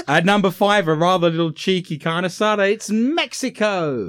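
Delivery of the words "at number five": ordinary delivery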